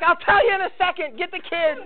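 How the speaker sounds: high, hurried orders in a panic; urgent panicked orders. Retold or imitated. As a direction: rush the husband to get the kids away. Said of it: "she's like".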